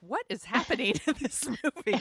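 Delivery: puzzled and amused at the movie; a touch of mock confusion with laughter; laughing bafflement. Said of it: "I was like".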